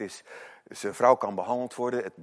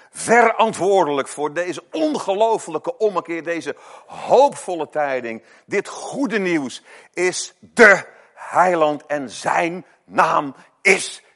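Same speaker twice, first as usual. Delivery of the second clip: luid, langzaam en plechtig gepreekt; vurige prediking; 7 semitones higher, 11 dB louder, slower than usual. Acted. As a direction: roep het uit over de gemeente, woord voor woord. Preaching at full force. (luide, langzame preekstem)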